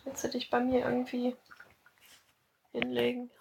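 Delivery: cooing to a cat. Real, not acted. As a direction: gently invite the pet closer.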